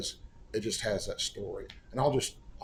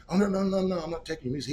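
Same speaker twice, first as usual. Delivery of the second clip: higher, louder run of no's; hasty protest; 8 semitones higher, 4 dB louder, faster than usual. Retold or imitated. Caller assuring the songwriter his music is safe, hasty protest. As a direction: rush to reassure, pitched up.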